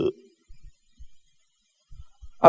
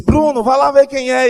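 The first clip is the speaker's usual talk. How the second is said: higher and louder call down the hall; retold shout; 12 semitones higher, 10 dB louder than usual. Seminarian calling his friend to the door, retold shout. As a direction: call out to Bruno in the next room. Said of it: "Aí eu gritei pra ele".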